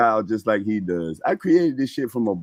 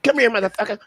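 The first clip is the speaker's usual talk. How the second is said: higher, gruff dog-like threat; rude dog voice; 9 semitones higher, faster than usual. Retold or imitated. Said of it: "in a rude dog voice".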